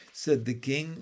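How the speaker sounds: ordinary delivery, nothing marked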